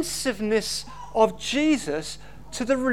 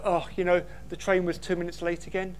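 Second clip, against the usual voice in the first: petty everyday moan; 6 semitones lower, 5 dB quieter, faster than usual. Retold or imitated. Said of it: "This is not the kind of".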